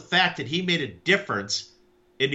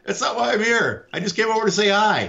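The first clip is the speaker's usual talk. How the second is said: high mock reassurance; 7 semitones higher, 3 dB louder than usual. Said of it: "I'm like".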